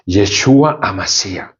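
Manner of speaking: slow, mock-solemn "Yeshua Amashiach"; affected Hebrew pronunciation. Some believers invoking "Yeshua Amashiach", affected slow Hebrew. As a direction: imitate people pronouncing "Yeshua Amashiach" in drawn-out would-be Hebrew. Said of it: "Así algunas personas".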